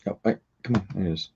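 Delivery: ordinary talk, nothing marked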